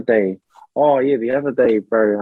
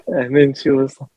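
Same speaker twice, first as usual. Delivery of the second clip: ordinary delivery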